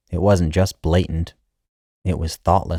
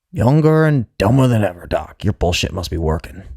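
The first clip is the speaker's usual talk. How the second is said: louder, higher sarcastic jab; sarcastic old man; 4 semitones higher, 3 dB louder than usual. Acted. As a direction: needle the doctor dryly. Old patient's sarcastic reply.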